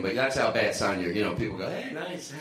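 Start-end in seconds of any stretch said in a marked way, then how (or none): 1.7-2.4 high, softened, drawn-out greeting; uneasy friendly greeting. Retold or imitated. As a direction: placate them in a high, careful voice. Mimicking overfriendly people backing away.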